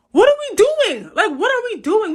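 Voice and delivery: high-pitched, incredulous exasperation; exasperated. Real, not acted. Answering y'all who keep putting us on your mind, exasperated. Exasperated retort to state's critics.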